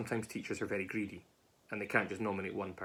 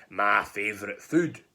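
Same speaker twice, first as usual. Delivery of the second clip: slow, loud monster voice; hungry gruffalo; 7 dB louder, slower than usual. Acted. Gruffalo spotting the mouse, slow loud monster relish.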